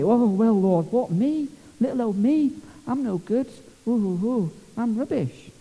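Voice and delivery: high, simpering mock-modest whine; false humility. Preacher mocks a churchgoer's false humility before God, simpering. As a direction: put on a fake humble wail, ooh-ing. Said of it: "all false humility".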